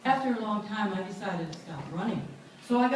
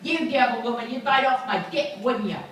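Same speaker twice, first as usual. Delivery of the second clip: accusing; 5 semitones higher, 6 dB louder than usual. Retold or imitated. Man accuses her in woods.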